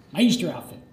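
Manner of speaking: ordinary delivery, nothing marked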